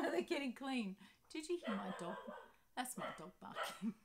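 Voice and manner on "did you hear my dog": usual speaking voice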